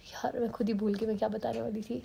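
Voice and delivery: whispered to herself, sheepish. Real, not acted. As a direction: breathe it out as a private aside. Forgot what she wanted to say. (खुद से फुसफुसाकर कहना)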